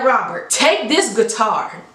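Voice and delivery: a hint of a smooth devil making an offer. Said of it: "He said".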